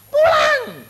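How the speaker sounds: high, loud urgent shout of a friend; shouted order. Retold or imitated. Friend shouting at the preacher to skip his exam, high loud yell. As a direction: yell at him to drop the exam and go home. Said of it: "teman-teman saya ke-, kedokteran bilang gini".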